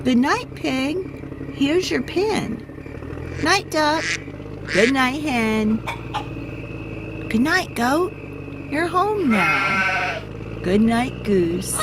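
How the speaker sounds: friendly truck. A narrator's reading. Blue says good night.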